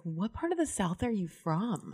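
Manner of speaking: soft, slow retold question; lightly voiced curious man; quoted salon guy. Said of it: "this guy was like".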